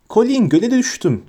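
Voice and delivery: put-on high-pitched little boy's squeal; thin, high piping voice; shrill voice. A narrator's reading. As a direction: pipe it up high to your brother. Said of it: "dedi tiz bir sesle".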